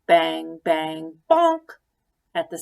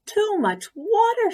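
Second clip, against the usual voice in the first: cried out; 12 semitones higher than usual. A narrator's reading. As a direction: shout it in a panic. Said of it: "she cried".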